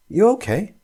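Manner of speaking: high, gentle worried question; concerned check-in. Acted. Tom checking on shaken Tina, gently concerned.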